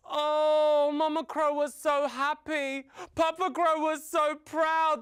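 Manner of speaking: high, gushing storyteller's delight; joyful, proud. A narrator's reading. Joy at the new egg.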